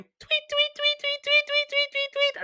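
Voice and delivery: very high, rapid tweeting; chirping chicks. Retold or imitated. Podcaster imitates newly hatched chicks, chirping. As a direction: squeak out a string of baby-bird chirps. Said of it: "they're all going".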